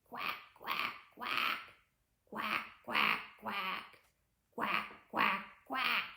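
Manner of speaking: playful duck quacking; duck noises. Shown as a demonstration. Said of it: "Let's do some barn animal noises".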